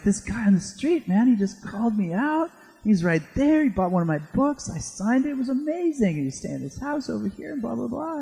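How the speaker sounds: high, excited rush; excited retelling. Retold or imitated. [name] tells his friend about the fan, high and excited. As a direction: bubble over with excitement.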